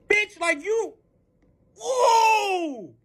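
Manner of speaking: high, halting exclamation ending loud; sputter, then let out a high whoop; too outraged for words; speechless outrage. Retold or imitated. Outraged when the woman comes out.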